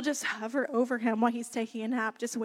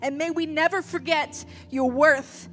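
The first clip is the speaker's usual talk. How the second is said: heartfelt prayer. Real, not acted. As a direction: lead the room in prayer, swelling with conviction. Leads a prayer.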